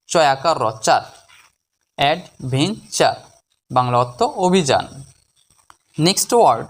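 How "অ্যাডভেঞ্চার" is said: slow, in three separate syllables; measured teaching breakdown. Shown as a demonstration. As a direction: pause between the parts of the word. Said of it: "এই শব্দটিকে ভাঙলে".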